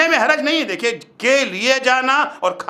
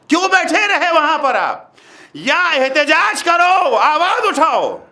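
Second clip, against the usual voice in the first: high, exhorting sermon voice, drawn out; forceful preaching; 8 semitones higher, 4 dB louder than usual. Real, not acted. Preacher rebuking those who sat silent, forceful preaching. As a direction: demand that they stand up and protest.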